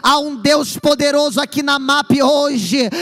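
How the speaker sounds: loud, slow, building preaching; preaching at full force. Acted. Preacher telling the congregation God never lost control, full-force preaching. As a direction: pound each phrase home to the church. Climax of sermon.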